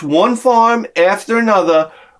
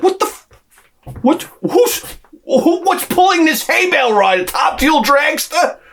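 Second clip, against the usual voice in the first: shocked disbelief; 12 semitones higher than usual. Retold or imitated. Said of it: "I'm like".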